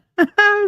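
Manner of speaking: a teary high laugh breaking out; laughing through tears. Real, not acted. Vlogger laughing through tears about her grandmother, teary high laugh. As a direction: laugh at yourself mid-cry.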